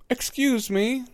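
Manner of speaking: put-on small, high, timid boy; tiny voice. A narrator's reading. Neville at the door to Hermione, tiny and timid. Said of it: "said the boy in a tiny voice".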